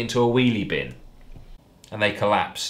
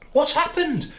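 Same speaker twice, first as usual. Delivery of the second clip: mock alarmed cry; 3 dB louder than usual. A narrator's reading. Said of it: "cried Jill".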